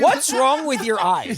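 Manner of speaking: high, drawn-out baffled question; mock alarm. Teasing a friend squinting across the table, mock alarm. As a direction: ask it slowly in hammed-up bewilderment.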